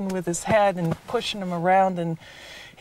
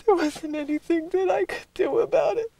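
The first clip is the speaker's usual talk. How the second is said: real tearful breakdown, high strained voice; sobbing through the words, pitched way up; crying; 12 semitones higher, 4 dB louder than usual. Real, not acted. Witness recalling the boy left behind, crying.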